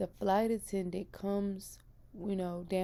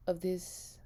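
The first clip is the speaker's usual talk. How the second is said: normal talking voice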